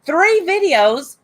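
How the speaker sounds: as if the accusation is absurd; exasperated disbelief. Real, not acted. Exasperated rebuttal to Nikki's accusation.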